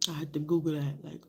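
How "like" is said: ordinary talk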